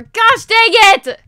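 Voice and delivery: high, loud, drawn-out outburst; frustrated yell. Real, not acted. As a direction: shout it out in exasperation. Frustrated at messing up the build.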